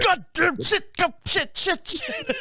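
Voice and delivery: high, strained mock cursing; mock rage. [name] imitating himself swearing at the door, high and strained. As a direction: curse at the garage door half-laughing. Acting his own fury.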